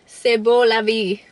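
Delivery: slow, put-on French accent. Shown as a demonstration. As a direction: try out a little French, drawing it out. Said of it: "that's my French accent for today".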